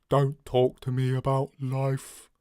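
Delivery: very slow, drawn-out monotone; slow, dreary robot. Acted. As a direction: drag every word out wearily. Trying deep voice, quotes gloomy robot.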